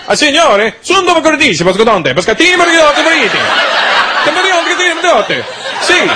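high-pitched gibberish Italian chatter; speaking Italian. Retold or imitated. Italians out on the street addressing him, high mock-Italian jabber. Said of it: "they started speaking Italian to me".

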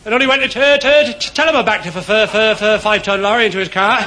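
high, loud, stuttering glee; excited stammer. Acted. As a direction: stutter out the story, delighted. Stammerer laughing to mate.

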